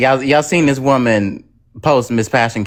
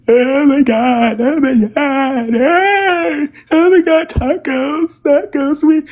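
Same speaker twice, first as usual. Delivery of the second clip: very high, slow, wailing fake sympathy; mock fake crying; 12 semitones higher, much slower than usual. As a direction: ham up fake sobbing concern. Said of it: "the boohoo tears".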